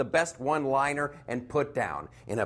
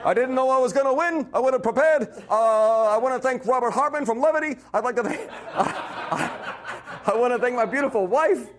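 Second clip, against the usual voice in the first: Bernie Sanders voice; 8 semitones higher, 4 dB louder than usual. Pretend. Bernie's acceptance speech.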